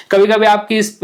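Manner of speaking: usual speaking voice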